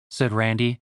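ordinary delivery, nothing marked

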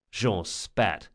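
ordinary delivery

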